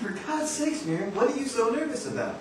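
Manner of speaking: high, coaxing, amused exasperation; teasing exasperation. Acted. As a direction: tease her out of her nerves. Producer coaxing nervous woman.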